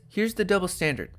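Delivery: usual speaking voice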